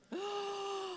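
soft mock gasp. Mock temptation over Diet Coke.

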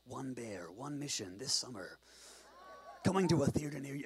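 mock-epic trailer narrator, in dramatic chunks; movie trailer voice. Preacher spoofs a summer bear movie, trailer voice. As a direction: parody a film trailer announcer.

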